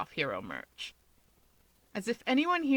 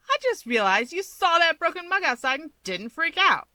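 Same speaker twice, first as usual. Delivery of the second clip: higher, louder, accusing; suspicious woman; 9 semitones higher, 5 dB louder than usual. A narrator's reading. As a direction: call him out. Rumi voiced, accusing.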